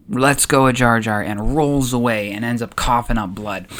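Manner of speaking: usual speaking voice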